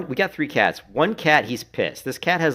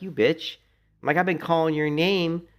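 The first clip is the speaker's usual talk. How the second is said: mock scolding; 9 semitones higher, 3 dB louder than usual. Scolding cat that popped up.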